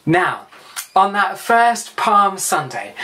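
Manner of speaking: ordinary talk, nothing marked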